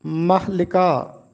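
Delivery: slow महलिका, "mah-li-kaa"; teacher's model of महलिका, "mah-LI-kaa"; correct pronunciation. Shown as a demonstration. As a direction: say महलिका slowly with i after l. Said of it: "जबकि लिका का मतलब होता है चेहरा".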